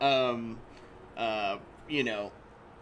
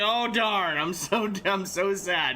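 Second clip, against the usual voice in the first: higher, louder mock disappointment; sarcastic; 6 semitones higher, 4 dB louder, slower than usual. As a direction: lay on the fake regret with a laugh. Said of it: "So he took that sarcasm".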